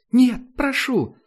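put-on high, loud, slow young woman's cry; desperate plea. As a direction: plead with everything she has. Girl begs him to stop. (громкая высокая мольба)

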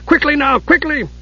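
put-on high hurried old dwarf; urgent dwarf voice. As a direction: hurry her along. Dugan urging Usha.

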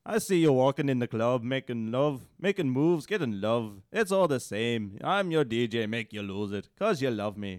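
loud, lilting Irish recital; Irish accent. Shown as a demonstration. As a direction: belt out lyrics in an Irish accent. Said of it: "read this in your best Irish accent".

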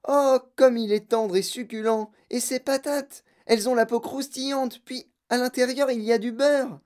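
high-pitched, gushing relish; excited raving. Acted. Girl describing roast beef course in gum, excited. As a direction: gush over every mouthful in a high voice.

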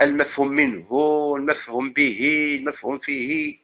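normal talking voice

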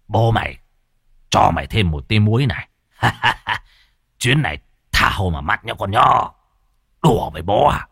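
mocking, self-satisfied snigger; smirking. A narrator's reading. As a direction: taunt the buried spirit with a smirk. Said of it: "mà nhếch mép".

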